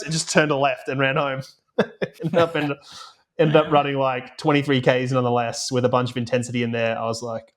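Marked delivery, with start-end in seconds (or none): none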